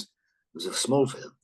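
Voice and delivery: slow, understated storyteller; Tony. Retold or imitated. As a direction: imitate a veteran actor reminiscing slowly. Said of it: "And he goes".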